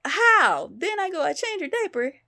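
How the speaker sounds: high, loud, baffled outburst; exasperated amazement, pitched high; baffled disbelief. Real, not acted. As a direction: exclaim it, utterly mystified. Baffled by how the mess spread.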